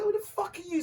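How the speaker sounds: high-pitched angry challenge; losing it, retold. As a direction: act out rejecting a stranger angrily. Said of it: "I'm still losing it".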